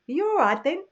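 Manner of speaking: lightly voiced Yorkshire greeting. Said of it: "they immediately say to you".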